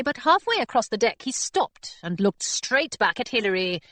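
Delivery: plain reading voice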